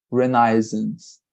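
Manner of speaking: "Renaissance" drawn out very slowly for the students; slow model pronunciation. Shown as a demonstration. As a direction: say "Renaissance" slowly so they can copy it. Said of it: "It's Renaissance like this".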